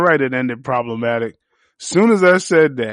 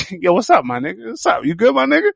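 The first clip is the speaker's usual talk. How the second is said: higher, concerned mock check-in; lightly voiced uneasy "you good" to a friend; 8 semitones higher, much faster than usual. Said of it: "[name]'s just sitting there like".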